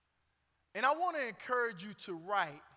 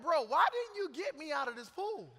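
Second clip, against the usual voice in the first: high-pitched mock indignation; playful, high-voiced protest; a little mad; 7 semitones higher than usual. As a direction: complain in comic exasperation. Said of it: "I'm a little mad".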